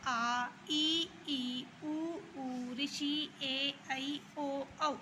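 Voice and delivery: teacher reciting the Hindi vowels to the class. Shown as a demonstration. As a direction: sound each vowel out separately, one after another. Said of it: "जब इन सभी स्वरों का".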